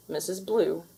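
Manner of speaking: plain reading voice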